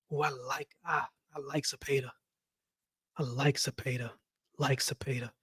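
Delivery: a soft aside about liking Zepeda; quiet musing. Real, not acted. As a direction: think it over half under your breath.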